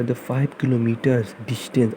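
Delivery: slow, word by word example; slow dictation. Shown as a demonstration. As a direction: dictate it slowly to the class. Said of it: "dictating example sentence slowly". (धीमे-धीमे बोलकर लिखवाना)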